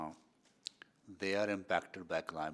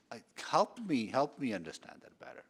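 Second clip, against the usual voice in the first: ordinary talk, nothing marked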